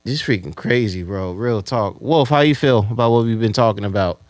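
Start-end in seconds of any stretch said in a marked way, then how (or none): none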